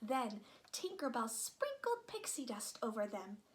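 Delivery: ordinary delivery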